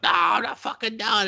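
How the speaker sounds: high, strained mock monster yell; Kong. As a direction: play a worked-up giant ape yelling back. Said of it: "and then Kong's like".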